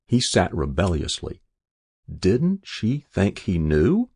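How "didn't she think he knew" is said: ordinary delivery, nothing marked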